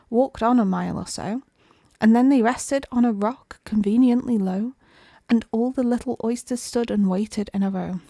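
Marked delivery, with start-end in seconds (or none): none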